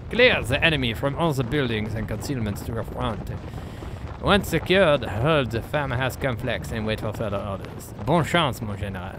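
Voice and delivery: hammed-up French accent with big swoops; thick, deliberately awful French accent; worst French accent. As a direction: read out orders like a bad stage Frenchman. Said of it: "I'm doing my worst French accent".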